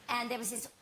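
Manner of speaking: ordinary delivery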